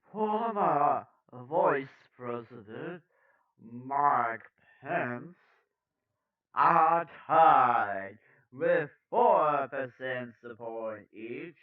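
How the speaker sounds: slow, emphatic news-anchor delivery; news read. Acted. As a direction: punch key words, lingering on figures.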